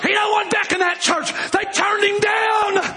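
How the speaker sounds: high, sustained pulpit cry; preacher erupting to drive it home; impassioned preaching shout. Real, not acted. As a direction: shout it high and draw out the last words.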